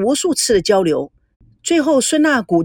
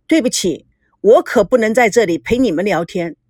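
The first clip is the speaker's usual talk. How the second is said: usual speaking voice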